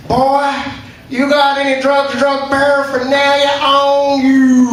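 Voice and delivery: put-on guard voice, last word stretched long; border guard. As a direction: bellow it like an officious border guard. Quoting US border guard questioning him.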